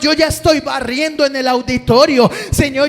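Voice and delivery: loud, high, driving sermon shouting; preaching at full force. Acted. Preacher calling the congregation to answer God, shouting. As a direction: preach it hard to the whole congregation.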